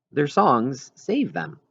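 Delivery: ordinary delivery, nothing marked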